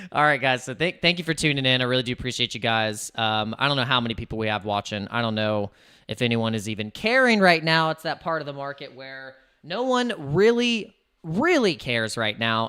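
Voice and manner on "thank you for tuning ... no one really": normal talking voice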